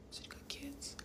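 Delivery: barely voiced whisper; hushed reaction. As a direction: whisper it as if taken aback. Hushed reaction to his news.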